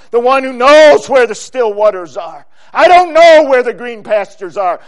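loud, driving preaching; full-force preaching. Real, not acted. Preacher on following the shepherd closely, full-force preaching. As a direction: drive it home loudly, rising at the end.

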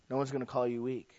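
ordinary talk, nothing marked

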